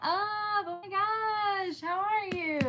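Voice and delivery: high, loud gushing hello; oh my gosh stretched out in delight; excited greeting. Real, not acted.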